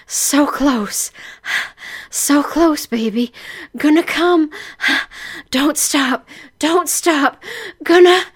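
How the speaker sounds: panting moans, climbing in pitch; breathless heroine. Acted. Heroine urging her lover on, breathless and building.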